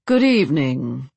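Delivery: deep, pompous English lord's voice; overdone English accent. A narrator's reading. Vampire lord greets his hostess, overdone English accent. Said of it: "replied in a deep voice. His accent was English and overly so".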